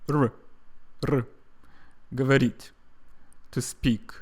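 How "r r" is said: "R" as a short, light trill; unemphasized Russian R. Shown as a demonstration. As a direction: tap "R" lightly with the tongue. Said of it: "You can just say".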